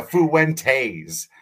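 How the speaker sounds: loud, slow foo-WEN-tayz; three-syllable Fuentes. Retold or imitated. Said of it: "like, a three-syllable thing".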